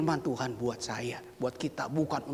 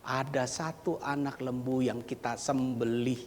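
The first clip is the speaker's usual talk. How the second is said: ordinary talk, nothing marked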